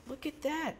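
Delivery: high, soft cooing reaction; mock admiration. Retelling his reaction to Cruz praising the team, mock admiration. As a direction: coo it softly, way up high. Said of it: "he was giving Team Alpha Male a bunch of props, and I was like".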